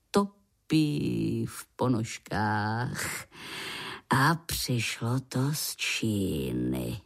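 low, slow grandfather voice; old grandpa. A narrator's reading.

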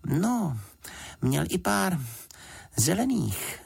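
put-on high little-girl voice; high, halting childlike remark; Juditka. A narrator's reading. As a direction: chime in as a girl, pitched high. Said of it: "řekla Juditka".

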